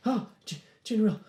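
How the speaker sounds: high, stammering, caught-out subordinate; flustered guard. Acted. Guard surprised by the general, flustered stammer.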